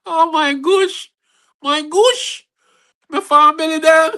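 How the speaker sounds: high, slow words still laughing. Real, not acted. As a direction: shake your head laughing at the family. Laughing about family.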